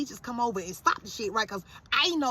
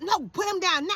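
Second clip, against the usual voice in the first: high-pitched protective order; retold mother demanding they stop; urgent demand; 8 semitones higher, 3 dB louder than usual. Acts out ordering boys off son.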